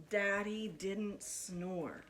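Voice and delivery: ordinary delivery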